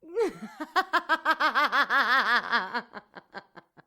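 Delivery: high, loud cackling laugh; gleeful evil cackle. A narrator's reading. Demon laughing at the man's complaint, cackling. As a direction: cackle with cruel delight.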